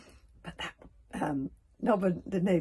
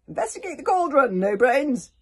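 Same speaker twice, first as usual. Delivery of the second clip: loud, high barked order; 7 semitones higher, 11 dB louder than usual. Acted. Stoic orders Nobber to investigate, loudly. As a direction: command him like a chief. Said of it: "ordered Stoic".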